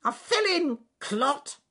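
high squeaky snappish retort; rude squirrel. Acted. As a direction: snap back in a squeaky squirrel voice. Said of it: "Squirrels are rather rude".

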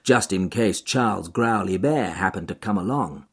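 ordinary delivery

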